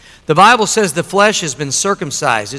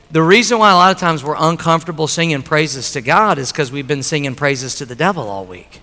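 ordinary talk

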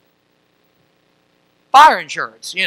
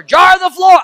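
mom's holler; 12 semitones higher, 8 dB louder than usual. Retold or imitated. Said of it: "she'd holler".

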